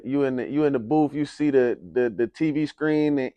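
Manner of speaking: ordinary talk, nothing marked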